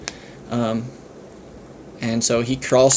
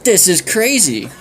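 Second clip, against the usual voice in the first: amazed; 7 semitones higher, slower than usual. Acted. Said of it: "Fillmore's like".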